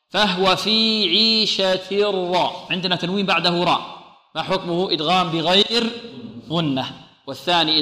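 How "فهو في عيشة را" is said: "فهو في عيشة را" drawn out very slowly; slow recitation. Shown as a demonstration. Teacher recites the phrase for students. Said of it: "واضح؟".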